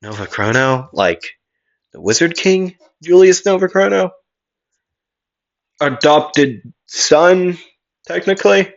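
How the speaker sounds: rising, questioning tone. Acted. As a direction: ask it in mild disbelief. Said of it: "in a questioning look and say".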